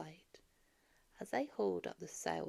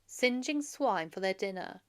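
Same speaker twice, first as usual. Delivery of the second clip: ordinary delivery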